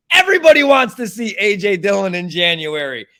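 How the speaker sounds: loud, high start on EVERYBODY, falling away; ranting, fed-up contradiction; exasperated rebuttal. Real, not acted. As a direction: hit EVERYBODY hard in indignant correction. Angry rebuttal of the quote.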